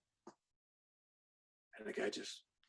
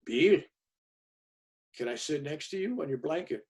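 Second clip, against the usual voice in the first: gentle request; 6 dB quieter than usual. Retold or imitated. Guru asks gently to sit.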